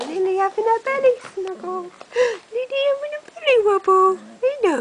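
high, loud and fast squeal of delight. Real, not acted. Mum gushing at the puppy's belly, excited high. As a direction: gush over the puppy.

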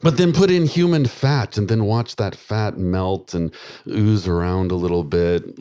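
ordinary talk

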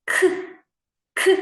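"к" as a short, crisp, isolated sound; sound demonstration. Shown as a demonstration. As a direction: tap out "к" on its own twice. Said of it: "Вимовляємо".

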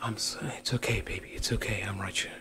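hushed, tender reassurance; soothing. Acted. Comforting baby while things may get worse, hushed and soothing. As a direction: soothe them very softly, almost whispering. Soothing partner during an episode.

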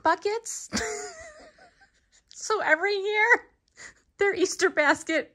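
high, giggling through the words; laughing. Real, not acted. Joking to viewers about puke buckets, laughing. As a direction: laugh it out at her own joke.

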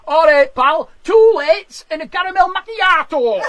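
booming Yorkshire bloke ordering coffee; loud Yorkshireman. Retold or imitated. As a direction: bellow the order across the hipster café. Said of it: "a Yorkshireman walk in and go".

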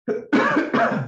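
sharp rough cough. Real, not acted. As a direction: clear a sore throat with a cough. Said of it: "Sorry, I'm losing my voice a little bit".

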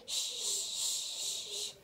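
soft unvoiced shush. Real, not acted. Hushing baby.

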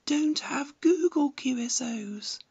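ordinary delivery

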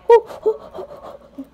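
high excited whoops; excited whooping. Pretend. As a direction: whoop it up with joy. Using powers, excited.

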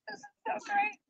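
barely holding back tears; tearful. Real, not acted. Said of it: "I'm gonna start crying".

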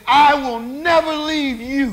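loud, high, drawn-out promise; preacher acting out Peter's bold pledge; emphatic vow. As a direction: proclaim undying loyalty, slowly and forcefully. Peter vows loyalty to Jesus.

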